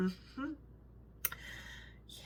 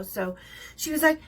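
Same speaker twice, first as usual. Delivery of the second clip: ordinary talk, nothing marked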